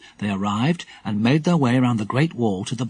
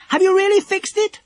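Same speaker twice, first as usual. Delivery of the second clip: very high, raised, eager question; excited shout; 4 dB louder than usual. A narrator's reading. Dinah to the boys on the wall, shouting excitedly. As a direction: call out in high-pitched excitement. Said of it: "shouted Dinah in excitement".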